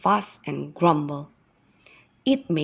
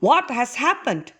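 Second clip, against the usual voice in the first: raised, urgent storyteller question; lightly acted alarm, higher; concerned questioning; 5 semitones higher than usual. A narrator's reading. Moses asking the yelling young man, concerned questioning.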